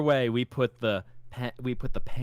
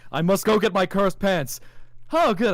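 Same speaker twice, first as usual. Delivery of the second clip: high, loud hammed-up declaration; playful theatrical proclamation, pitched up; mock-heroic; 9 semitones higher, 7 dB louder than usual. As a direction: proclaim it like an overacting hero. Mock-heroic acting out of cursed pants.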